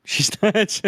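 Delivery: high, loud laughing joke. Real, not acted. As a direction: blurt it out through laughter. Players joke, laughing loudly.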